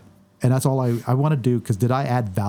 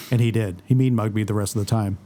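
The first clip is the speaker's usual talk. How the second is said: ordinary talk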